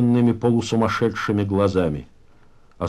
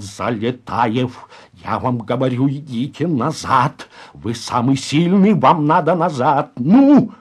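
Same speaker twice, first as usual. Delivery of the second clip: angry insistence; 2 semitones higher, 4 dB louder than usual. Acted. Староста orders Залетаев to the back, increasingly angry. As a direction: push harder until the last word bursts out.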